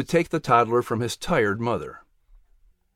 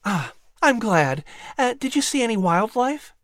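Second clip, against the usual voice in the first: much higher, altered mother's voice; a cheerful mother checking on her son; mother asking Pat about fishing; 9 semitones higher than usual. Acted.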